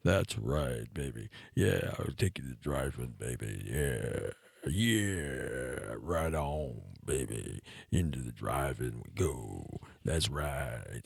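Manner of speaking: very low, soft croaking seducer; exaggerated frog-low ladykiller; deep voice.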